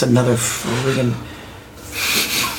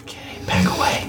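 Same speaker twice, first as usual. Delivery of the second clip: whispering; 4 dB quieter than usual. Real, not acted. Whispering near sleeping bats.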